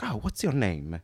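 put-on comic Italian-accented English; comically Italian. Italian driver getting acquainted, comic Italian accent. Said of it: "the comically stereotypical accent".

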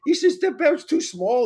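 imitating a Hawaiian surfer, high and loud; light Hawaiian surfer voice; mock Hawaiian accent. Retelling the surf guys, in a Hawaiian accent. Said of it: "they talk in Hawaiian accent".

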